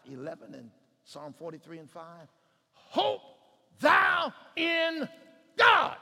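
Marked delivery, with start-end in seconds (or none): 2.7-6.0 high, loud, word by word; ringing pulpit shout; shouted proclamation. Acted. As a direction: cry out each word separately. Proclaims the verse.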